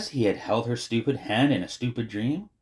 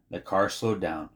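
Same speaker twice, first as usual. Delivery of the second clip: usual speaking voice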